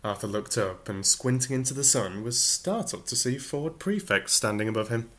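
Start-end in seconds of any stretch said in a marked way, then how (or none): none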